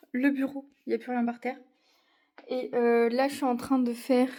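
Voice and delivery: ordinary talk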